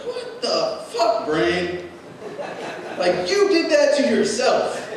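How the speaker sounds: high, strained yell at your own brain; mock exasperated yell. Waking from a nightmare, yelling at his own brain, half laughing. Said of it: "just wake up and be like".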